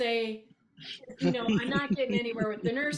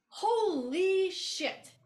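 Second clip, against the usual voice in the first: slow, drawn-out, high and louder; stunned holy shit; 9 semitones higher, 4 dB louder, much slower than usual. Retold or imitated. Inner reaction to the nurse, slow stunned disbelief. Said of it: "I went".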